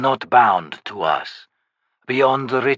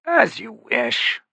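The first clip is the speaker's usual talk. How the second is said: high, smooth, courtly voice; coolly understate a slaughter; a lightly voiced urbane nobleman; urbane sorcerer; 10 semitones higher, much slower than usual. Beauchelain allows it.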